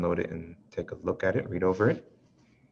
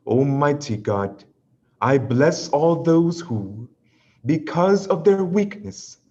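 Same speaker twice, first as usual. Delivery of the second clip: louder, measured prayer reading; prayer recitation; 5 semitones higher, 5 dB louder, slower than usual. Acted. Leads a spoken prayer.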